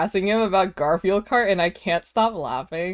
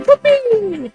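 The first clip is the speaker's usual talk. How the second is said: excited cheer; 6 dB louder than usual. Real, not acted. Cheering along to birthday track.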